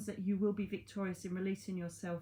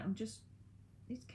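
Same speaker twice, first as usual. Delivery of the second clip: normal talking voice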